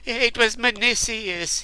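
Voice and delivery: high, tearful plea; weeping. A narrator's reading. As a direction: say it through tears. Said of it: "he wept".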